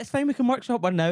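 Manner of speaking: squeaky mimic of the podcast hosts; high mimicking voice. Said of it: "they were like".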